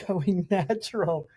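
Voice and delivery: ordinary talk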